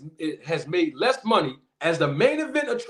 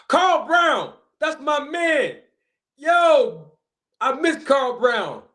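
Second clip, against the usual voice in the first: high, loud, delighted shout-out; excited greeting; 7 semitones higher, 3 dB louder, much slower than usual. Real, not acted. Host greeting longtime supporter Carl Brown in chat, excited and high.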